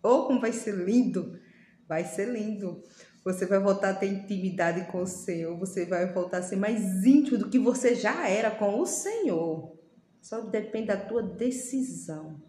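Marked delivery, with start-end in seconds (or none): none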